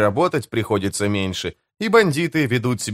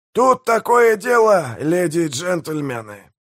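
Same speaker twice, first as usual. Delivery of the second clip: slow, high-pitched showman's address; announcing; 6 semitones higher, slower than usual. A narrator's reading. Pirate mayor addresses his crew, slow high announcement. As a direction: draw it out grandly for the assembled crowd. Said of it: "объявил Пиви".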